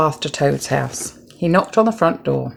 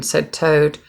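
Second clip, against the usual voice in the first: usual speaking voice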